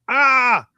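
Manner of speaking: short high, loud roar; hammed-up angry "ah"; mock enraged yell. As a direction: burst out in imitated rage. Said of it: "enraged about".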